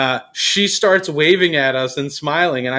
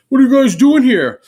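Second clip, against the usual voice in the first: higher, louder challenge; mock confrontational shout; 10 semitones higher, 6 dB louder than usual. Stranger challenges the film crew, confrontational shout. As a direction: bark it like a nosy stranger.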